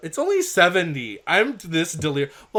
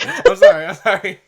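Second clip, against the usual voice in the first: laughing apology; 7 semitones higher, 8 dB louder than usual. Real, not acted.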